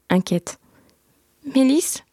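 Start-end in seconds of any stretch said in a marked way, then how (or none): 1.5-2.0 a lightly voiced little boy, higher; small boy calling his sister on the phone; hint of a child's voice, higher. A narrator's reading. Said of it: "Une petite voix au bout du fil, enfantine".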